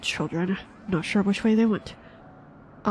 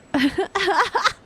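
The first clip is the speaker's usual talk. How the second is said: high laugh; 11 semitones higher, 4 dB louder than usual. Real, not acted. Bursts out laughing.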